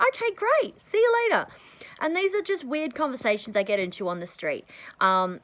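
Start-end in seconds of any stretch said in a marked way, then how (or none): 0.1-1.5 cheery goodbye. Retold or imitated. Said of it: "and I'll go".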